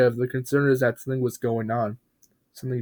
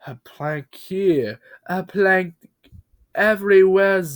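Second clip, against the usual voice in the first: building raving rant; 7 semitones higher, 5 dB louder, slower than usual. Acted. Bob raving while building.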